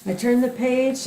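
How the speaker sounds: ordinary delivery, nothing marked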